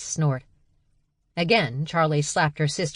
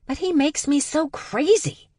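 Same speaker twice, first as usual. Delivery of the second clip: raised, exasperated outburst; angry frustration; 13 semitones higher, 3 dB louder than usual. Acted. Charlie venting about her father, exasperated. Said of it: "that angry feeling washed over her".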